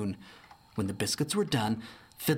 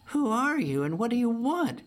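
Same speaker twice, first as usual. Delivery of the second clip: much higher, timid bear voice; shy Barbara; 11 semitones higher, 3 dB louder than usual. Acted. Barbara asks the stranger at her door, shyly. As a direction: ask it bashfully, high. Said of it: "she asked shyly".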